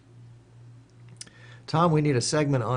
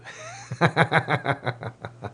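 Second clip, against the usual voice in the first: real laugh; 3 semitones higher than usual. Real, not acted. Laughs at his own blunder.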